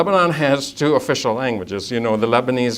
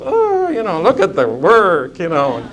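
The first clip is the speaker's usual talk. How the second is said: drawn-out reluctant complaint; grumbling clerk; 4 semitones higher than usual. Retold or imitated. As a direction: play a put-upon official stalling. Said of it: "He's frowning all the time".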